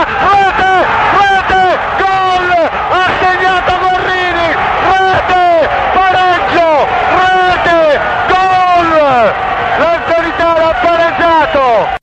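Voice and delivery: shouted goal call, rising high; breathless italian goal commentary. Real, not acted. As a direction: yell the goal at full voice. Archive radio goal call.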